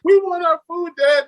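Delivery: mock kids demanding of their dad; complaining kids. Kids tired of fans, demanding food.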